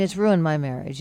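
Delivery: usual speaking voice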